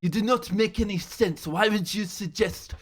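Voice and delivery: a put-on French accent, scolding a friend; mock French accent. Classmate scolding [name] about cars, in a mock French accent. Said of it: "a French accent ... mimic her yelling at me".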